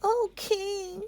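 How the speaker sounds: a scared small creature pleading; rabbit voice. Acted. The rabbit pleading with King Lion, high and timid. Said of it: "said the rabbit".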